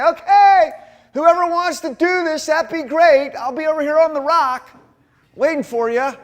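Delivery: high, loud, hammed-up announcement; mock loud call. Pretending Jesus casually invites followers to the rock, mock loud call. Acts out what Jesus didn't say.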